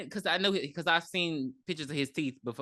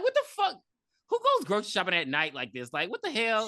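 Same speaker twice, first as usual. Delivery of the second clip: mock outrage; 4 semitones higher than usual. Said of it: "I'm like".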